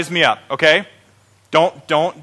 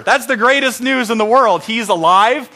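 ordinary talk

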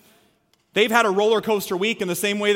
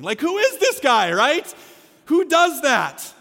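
incredulous; 7 semitones higher, 4 dB louder, slower than usual. Real, not acted. Incredulous comic aside.

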